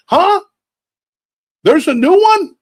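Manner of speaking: very high, loud confusion; baffled boomer. Retold or imitated. Boomer hearing of the New Testament, high and loud. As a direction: yelp it like a baffled old man.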